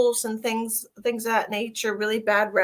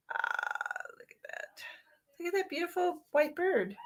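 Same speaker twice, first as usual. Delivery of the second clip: whispered, softer look at that; hushed awe; 7 semitones higher, 10 dB quieter than usual. Real, not acted. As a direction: breathe it out in wonder. Marveling at a card image.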